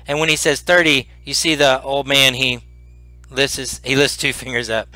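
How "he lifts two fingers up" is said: normal talking voice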